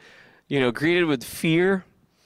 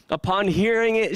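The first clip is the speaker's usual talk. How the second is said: ordinary talk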